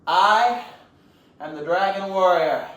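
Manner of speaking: loud, stretched-out declaration; grand pause after "I"; heroic announcement. Acted. Panda introduces himself to the villain, loudly heroic.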